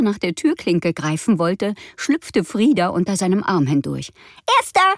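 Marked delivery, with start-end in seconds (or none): 4.6-4.9 high, loud triumphant call. A narrator's reading. Frieda beats her brother to the door, calling out high. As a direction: crow it like a winner. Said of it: "rief sie".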